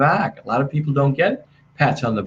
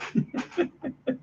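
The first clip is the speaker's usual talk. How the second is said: high, soft laugh; quiet chuckle after the impression; 12 semitones higher, 11 dB quieter than usual. Real, not acted. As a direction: laugh softly at your own act. Laughs after his own impression.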